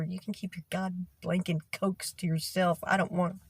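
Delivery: normal talking voice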